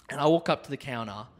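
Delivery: usual speaking voice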